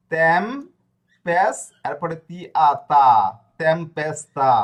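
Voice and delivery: তেম পেস তা built up loudly and slowly; sounded-out syllables. Shown as a demonstration. Teacher assembling a Spanish word for the class, loud and slow. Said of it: "উচ্চারণ কি করবে?".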